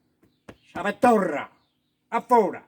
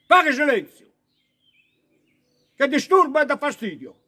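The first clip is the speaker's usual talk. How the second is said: demanding silence; 3 semitones higher, 4 dB louder than usual. Acted. Hushing the noisy class.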